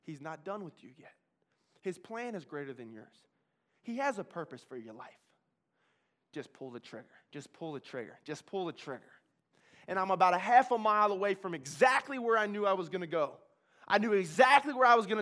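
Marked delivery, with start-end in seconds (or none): 0.0-9.1 much softer, lower gentle voice; soft inner voice speaking to him. Retold or imitated. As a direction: speak it gently like a comforting whisper. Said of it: "this soft voice on his shoulder".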